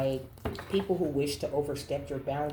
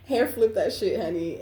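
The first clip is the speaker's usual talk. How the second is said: "Hair flip that shit" repeated louder and higher, sassy; sassy emphasis; 6 semitones higher, 7 dB louder than usual. Shown as a demonstration. Reader repeating the card's line to her viewers, with louder diva sass.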